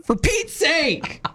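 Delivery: prim, fussy exasperation at an email; mock mild annoyance. Imitating cohost reacting to an annoying email, fussy huff. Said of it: "your angry response is".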